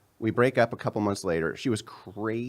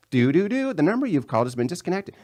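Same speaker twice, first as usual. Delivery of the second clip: mock phone recording; 7 semitones higher than usual. Imitating disconnected tone recording.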